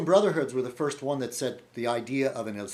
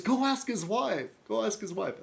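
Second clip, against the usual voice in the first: lightly voiced teasing friend; imitating Nick; 9 semitones higher than usual. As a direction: neighbor pranking him, high and insistent. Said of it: "then he goes".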